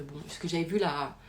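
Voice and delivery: ordinary talk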